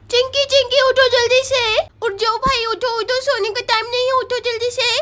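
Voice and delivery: put-on high, rushed girl's voice in a panic; a schoolgirl realising she overslept; panicked little girl. Schoolgirl wakes her friend on test day, panicking.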